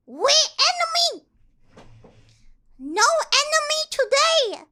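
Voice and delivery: loud, high, caveman-style orc shouting; dumb orc voice. Pretend. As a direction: bellow it like a brainless space orc picking a fight. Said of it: "do they talk like this?".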